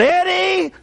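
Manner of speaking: high, drawn-out imitated call; retold holler. Girl calling the man upstairs, imitated holler. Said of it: "she hollered".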